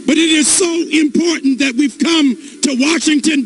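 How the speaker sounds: ordinary talk, nothing marked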